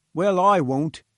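with decision. Acted. Head engineer refuses to harpoon the beast, firm and decided. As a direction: put your foot down. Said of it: "with decision".

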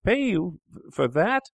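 high, incredulous yelp; can't believe what he hears; shocked cry. Acted. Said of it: "he cried".

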